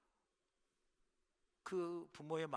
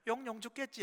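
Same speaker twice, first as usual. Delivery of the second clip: mocking taunt; 10 semitones higher, 6 dB louder than usual. Taunting his mother while fleeing.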